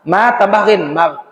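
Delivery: ordinary talk, nothing marked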